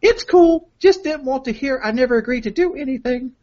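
high-pitched put-on girlfriend voice; hammed-up girly texting voice. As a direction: read it in a mock-girlish falsetto. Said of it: "I'm fucking up with the voice".

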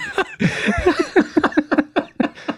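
long squealing laugh; high laugh. Real, not acted. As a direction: keep laughing at the burn. Laughing at own burn.

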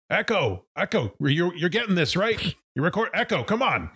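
high-pitched shout to someone below; mock shouting. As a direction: call out urgently in a high, strained mock yell. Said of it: "he's just shouting".

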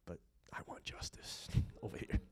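low whispered sneaky aside with a chuckle; sly hypocrite. Pretend. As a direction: whisper it like a selfish secret wish. Mocking double standard for others.

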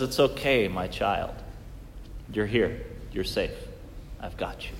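put-on soft, low, hushed comfort to a child; tender reassurance. God comforting his child after a tantrum, softly reassuring. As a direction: cradle the words like calming a crying toddler. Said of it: "he says".

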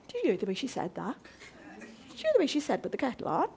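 mild mock grumble; resentful muttering. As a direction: sulk over a slight. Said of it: "you're like that".

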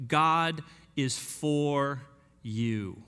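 God is for, pause, you, very slowly; stress on FOR YOU. Acted. Preacher to doubters, slow stress on for you. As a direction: hold back, then land on you.

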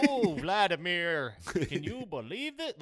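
hammed-up, slow Russian accent, pitched high; bad Russian accent. Podcaster mocking improv students' voice acting, bad Russian accent. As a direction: overdo a fake Russian accent, drawing it out. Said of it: "Okay, now do it in Russian".

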